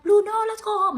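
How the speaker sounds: high, loud imitation of pleading friends; urgent intervention. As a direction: call out urgently to stop a friend. Friends pulling her away from fight.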